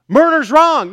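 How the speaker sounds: loud, high indignant cry; a voice protesting against the argument; loud objection. Acted. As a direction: blurt it out in protest. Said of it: "acted objection".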